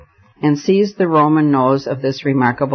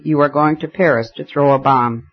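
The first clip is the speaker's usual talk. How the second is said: ordinary delivery, nothing marked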